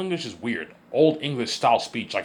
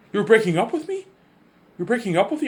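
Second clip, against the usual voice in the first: high-pitched imitation of an actress's put-on accent; V J's reel accent; 12 semitones higher, 5 dB louder than usual. Recalling a woman's reel to show off her accent, high-pitched mimicry. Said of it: "V J can do a pretty good accent".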